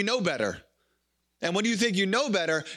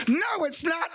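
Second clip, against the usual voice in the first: put-on a child's protest, pitched higher and shouted; whiny kid; 8 semitones higher, slower than usual. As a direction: protest like a stubborn little kid. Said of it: "and the kid's, like".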